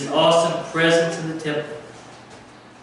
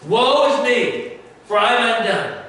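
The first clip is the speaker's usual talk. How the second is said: anguished cry; 7 semitones higher than usual. Acted. Isaiah in the temple, filled with fear, cries woe. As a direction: cry out in dread before a holy God. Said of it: "can only cry out".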